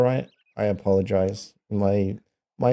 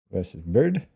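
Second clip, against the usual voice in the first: ordinary talk, nothing marked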